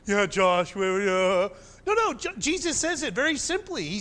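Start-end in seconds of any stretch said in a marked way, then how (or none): 0.1-1.5 shrugging, dismissive yeah, a touch louder; mock listener reply. Pastor mimics congregation brushing him off, mock dismissive. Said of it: "You're like".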